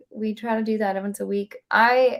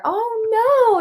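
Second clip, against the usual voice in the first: mock oh no; 13 semitones higher, 6 dB louder than usual. Mom retells son's pretend bonk.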